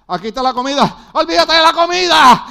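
subir de aviso a grito agudo; diálogo casero actuado que estalla en grito; grito de pareja. Acted. (grito exaltado en plena escena doméstica)

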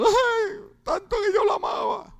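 high, hammed-up wailing widow; mock crying widow. Preacher mimics widow grieving at the funeral, mock wailing. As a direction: fake sobbing over the husband, high. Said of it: "Y la mujer llorando".

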